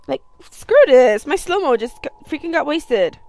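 loud fed-up shout; frustrated outburst. Real, not acted. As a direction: blurt it out, sick of the game. Frustrated gamer dies in game.

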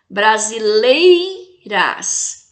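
"Brasileiras" very slow, "lei" long and strong, brief break before "ras"; stressed penultimate syllable. Shown as a demonstration. Teacher showing the syllable she says strongest, "lei" stressed in "Brasileiras". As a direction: stretch and lean on "lei" in "Brasileiras". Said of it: "que eu falo com mais intensidade".

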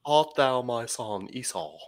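lightly attempted English accent; half-hearted English accent on old scripture. Shown as a demonstration. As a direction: try a tentative British English reading. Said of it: "say that more in an English ac-, accent".

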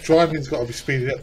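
ordinary talk

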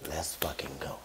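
low whispered retold plea; hushed urging. Urging his friend James to leave, hushed and insistent.

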